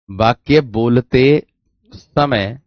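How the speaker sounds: वाक्य बोलते drawn out, a gap before समय; slow dictation. Shown as a demonstration. Teacher writing by hand for students, slow word-by-word dictation. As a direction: stretch वाक्य बोलते slowly, pause, then समय.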